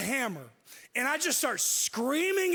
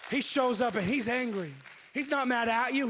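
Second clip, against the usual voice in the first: ordinary talk, nothing marked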